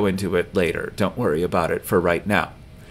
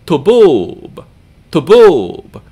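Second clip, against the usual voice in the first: tuboob with short tu, long loud BOOB; stress on second syllable; 7 semitones higher, 10 dB louder, much slower than usual. Shown as a demonstration. Teacher showing stress and duration, tuboob stressed on its long end. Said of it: "give the long syllable stress and duration".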